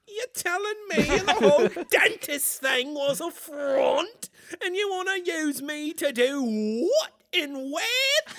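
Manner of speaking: incredulous British movie parody. Acted. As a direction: question it all in a comic British accent. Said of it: "Can we do my British voice again?".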